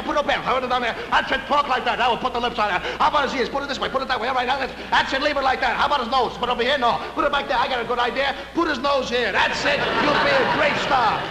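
a frantic makeup artist fussing over hair; fussy makeup man. Acted. Makeup man fussing over the new discovery, high and busy.

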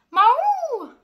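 high, loud cartoon cat cry; hissing cat. The woken cat hissing back, high and loud. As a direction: yowl like a startled cat. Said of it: "who hissed".

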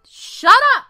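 ordinary delivery

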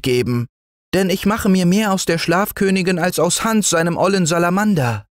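put-on high, hurried childlike bargaining; pleading child offering treasures; eager little boy. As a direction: tumble out the bribe excitedly.

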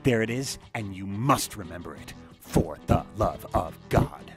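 milk it like a stage tragedian; melodramatic. Shown as a demonstration. Voice reel, melodramatic reading of "melodramatic.

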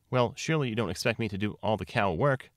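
ordinary delivery, nothing marked

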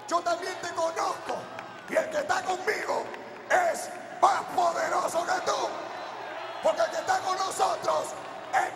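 shouting rebuke. Retold or imitated. Pastor confronting the demon in the hospital, shouting. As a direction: roar your authority over the demon.

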